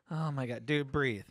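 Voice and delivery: usual speaking voice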